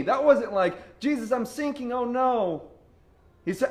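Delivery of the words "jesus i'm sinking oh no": high, half-hearted cry of distress; mock alarm. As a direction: play a token panic, short of a scream. Mock take of Peter's cry.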